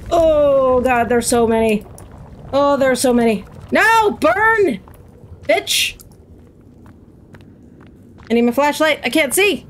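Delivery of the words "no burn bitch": high, frantic fighting cries; playful battle cry. Pretend. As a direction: cry out high while fending off a swarm. Fighting off swarming game demons.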